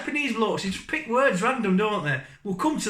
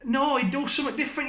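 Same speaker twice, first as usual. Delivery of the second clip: mock frustrated mate; 7 semitones higher, 3 dB quieter, faster than usual.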